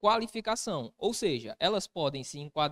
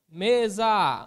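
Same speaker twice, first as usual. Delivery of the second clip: "Mesa" loud, with ME held and stressed; stressed syllable demo; 2 semitones higher, 9 dB louder than usual. Shown as a demonstration. Teacher asking where the tonic syllable is, loud stress on ME in "Mesa". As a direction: call out ME-sa, hitting the ME hard. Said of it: "onde é que tá a minha sílaba tônica".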